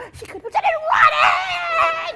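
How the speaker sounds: very high, long, loud yell; drawn-out shriek on running; screamed outburst. A narrator's reading. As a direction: explode from hush into a scream. Sudden dash for the gate.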